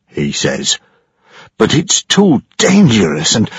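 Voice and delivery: an anxious ship's engineer; frightened engineer. Acted. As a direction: engineer pointing out damage over the noise. Engineer complains about captain.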